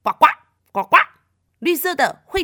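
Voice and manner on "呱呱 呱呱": imitated frog ribbits; short punchy frog calls; frog croak. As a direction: croak twice as a riddle clue. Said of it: "小美好像变成了一种动物".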